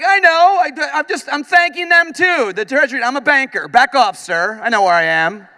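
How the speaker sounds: high, loud flustered defensiveness; flustered drunk. Retold or imitated. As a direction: bluff your way out, too loud. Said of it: "I was like".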